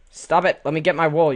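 loud scolding, starting high and easing off; annoyed shout. Real, not acted. Player yelling at a spider blocking the wool, annoyed shout. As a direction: yell at it to back off.

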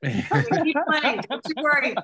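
high, giggly insistence; laughing reassurance. Real, not acted. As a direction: say it through rising laughter. Joking reassurance, laughter breaking in.

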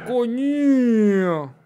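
long drawn-out exclamation, sliding down in pitch; startled curse. Real, not acted. Gamer reacting mid-race, a startled, stretched-out curse. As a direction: stretch the swear word into a falling wail.

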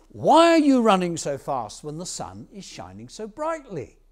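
loud storybook shepherd's question; calling out. Acted. Shepherd questions the running farmer, loud call. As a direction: call it out loudly in swooping tones.

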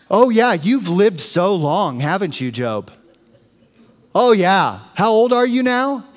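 mock-impressed sarcasm, leaning hard on the words; sarcastic. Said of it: "He gets real sarcastic".

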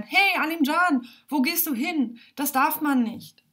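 mock shouted telling-off; calling out in protest. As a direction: voice a crowd calling after a runaway boy. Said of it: "dann würden alle schreien".

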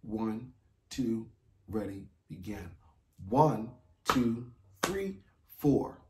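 steady count-in with a cue. Shown as a demonstration. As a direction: count them in evenly. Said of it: "here we go".

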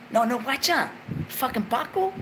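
ordinary delivery

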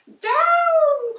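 high, loud cry of down; urgent loud witch cry; cried out. A narrator's reading. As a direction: shout it down to the ground. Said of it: "cried the witch".

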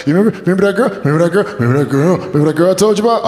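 high, stuttering tearful babble; imitating a weepy friend, words tumbling out; mock crying. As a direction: keep restarting as if choked by tears. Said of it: "really crying right now?".

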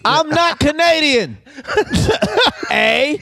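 high, drawn-out mock Canadian denial; Canadian impression cracking into laughter; badly hidden Canadian. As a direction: deny it in a comic Canadian accent. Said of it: "A Canadian who is not good at hiding".

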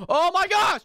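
high, loud outburst; real gamer rage yell; frustrated shout. Real, not acted. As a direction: shriek it in exasperation. Failing again in game.

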